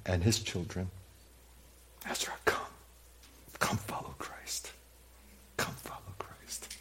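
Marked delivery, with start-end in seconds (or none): 2.1-6.7 whispered invitation. Retold or imitated. Father gently inviting his son Ezra to follow Christ, whispered. Parent calling child to Christ.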